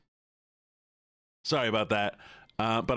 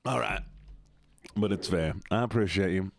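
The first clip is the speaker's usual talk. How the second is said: ordinary talk, nothing marked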